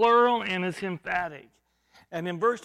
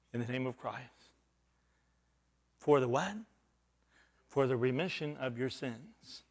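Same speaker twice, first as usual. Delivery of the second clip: soft recital; 8 semitones lower, 6 dB quieter, faster than usual. Real, not acted. Quiet recital of Acts 2:38.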